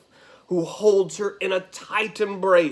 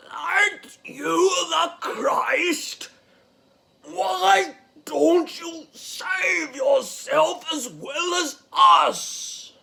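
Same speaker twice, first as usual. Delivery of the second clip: high, slow, strained wailing taunt; anguished thief; 10 semitones higher, slower than usual. Acted. Crucified Gestas demanding Jesus save them, high strained wail. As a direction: drag out each word in a high strained cry. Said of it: "Pulling himself up to breathe".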